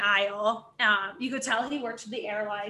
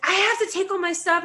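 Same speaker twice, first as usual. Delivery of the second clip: high-pitched retold protest; indignant disbelief; 8 semitones higher, 4 dB louder than usual. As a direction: imitate a passenger balking at the request. Retold passenger objecting to request.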